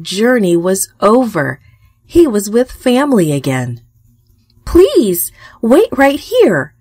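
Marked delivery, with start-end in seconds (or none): 4.6-6.8 high, eager plea, drawn out; excited exclaim. A narrator's reading. Rachel to Jacob at the well, excitedly asking him to wait. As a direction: Rachel jumps in, bright and eager. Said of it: "Rachel exclaimed".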